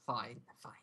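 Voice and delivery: weary whispered sigh. Acted. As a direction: grudgingly breathe the words. NPC reluctantly agrees, whispered.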